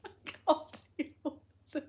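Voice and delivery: breaking up with laughter; laughing. Real, not acted. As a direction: squeak it out through giggles. Cracking up at chat.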